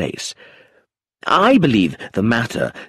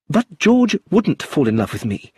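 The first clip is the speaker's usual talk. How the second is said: cried out; 6 semitones higher than usual. A narrator's reading. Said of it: "she cried".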